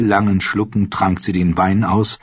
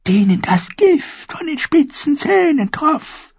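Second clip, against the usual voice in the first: put-on old dwarf seeress; 4 dB louder than usual. A narrator's reading. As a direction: recall the dream like a sad old crone. Seeress tells her dreams.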